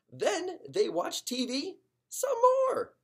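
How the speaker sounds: exasperated wail. A narrator's reading. Exasperated hamster at endless TV.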